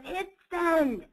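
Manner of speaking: hammed-up booming command; imitated booming voice. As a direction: mimic a booming order with a high, stretched push. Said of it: "in his booming, deep voice".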